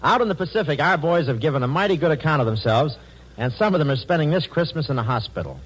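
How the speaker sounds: formal network announcer read; booming wartime radio introduction. Real, not acted. Network announcer hands over.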